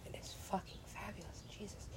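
almost silent, reverent whisper that stresses fabulous; awed whisper. Real, not acted. Gamer gushing over the headdress, near-silent whisper.

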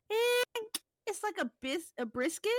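high eh then hesitant brisket; high-pitched hesitant cook impression; redo of the cook. Shown as a demonstration. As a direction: redo the squinting cook's answer. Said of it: "let me redo it".

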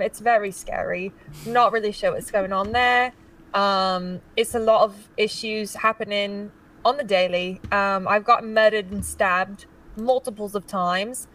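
put-on London accent, in character; amateur British character voice; best British accent. Shown as a demonstration. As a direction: introduce yourself as a roleplay Londoner with a British accent. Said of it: "Give us your best British accent".

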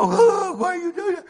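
high, loud wail of protest; exaggerated high-pitched whine; mock complaint. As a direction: cry out a mock objection. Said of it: "We don't go".

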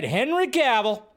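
light up at the name; excited name drop. Real, not acted.

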